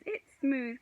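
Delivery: high, loud exclaim; excited high call; cried out. A narrator's reading. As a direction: exclaim at the smooth trunk. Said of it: "cried Stu".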